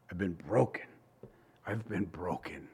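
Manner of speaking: retold tearful words. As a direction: quietly relay a friend breaking into tears. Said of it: "And he'd just start crying".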